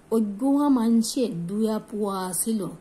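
slow Chittagong dialect sentence, said as locals say it; regional dialect. Shown as a demonstration. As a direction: give "অজ্ঞা মানসে দুয়া পুয়া ছিল" in the local Chittagong dialect. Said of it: "কিন্তু দেখো". (চট্টগ্রামের আঞ্চলিক উচ্চারণে ধীরে বলা)

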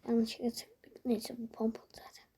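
hushed unvoiced whisper. Real, not acted. Gamer whispering commentary mid-match, whispered. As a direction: keep it to a whisper. Whispering during stealthy play.

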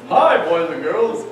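slow, lightly voiced storybook character greeting kids; a hint of a friendly talking dictionary, slowed down; Mister Dictionary voice. Teacher calls in Mister Dictionary.

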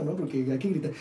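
normal talking voice

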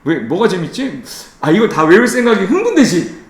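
normal talking voice

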